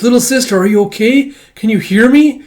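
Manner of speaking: high, loud worried call; anxious little fox. Acted. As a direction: reach out to her anxiously. Kippa calling to sister.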